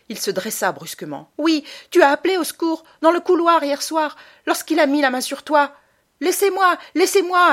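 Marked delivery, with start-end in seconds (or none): 1.4-5.8 loud, much higher furious cry; angry outburst. Acted. Boy furious at his mother, shouting. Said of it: "Edgar se mit en colère". 6.2-7.5 high, loud mock plea; imitated cry. Boy mimicking mother's cry for help. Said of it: "as-tu crié".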